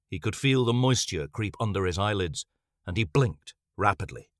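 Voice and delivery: ordinary delivery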